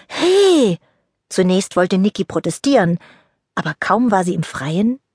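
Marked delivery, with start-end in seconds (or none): 0.1-0.8 high, loud startled protest; indignant hey. Acted. Niki is pushed outside and objects indignantly. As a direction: yelp of protest.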